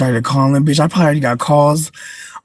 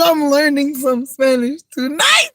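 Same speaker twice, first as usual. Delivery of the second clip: laughing; 11 semitones higher, slower than usual. Real, not acted. Laughing high.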